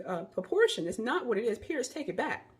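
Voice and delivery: usual speaking voice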